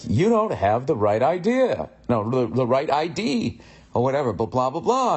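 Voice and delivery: mock sing-song, high swooping pitch; sing-songy. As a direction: lilt it up and down, passive-aggressive.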